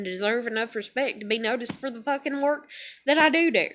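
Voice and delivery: rapid-fire run of despairing thoughts; spiralling self-talk. Acted. Overwhelmed worker arguing with herself in her head, rapid spiralling rant. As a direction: rattle it off as thoughts pile up.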